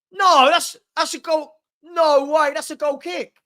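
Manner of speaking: high, loud burst of disbelief; excited outcry. Real, not acted. Watching the match, fan shouts in shock, high and loud. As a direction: yelp it out, thinking they scored. Mistakes a goal kick for a goal.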